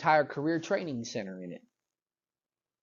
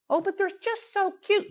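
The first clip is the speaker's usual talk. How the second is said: very high gushing coo; mock a parent cooing over a cute child; squeaky, mocking baby-talk gush; doting parent. Doting parent objecting to discipline.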